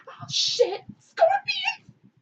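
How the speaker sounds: hammed-up, very high scream of alarm; comic horror, rising to a loud shriek; mock panicked shriek. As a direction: shoot up into a piercing cry. Mother finds scorpions on baby.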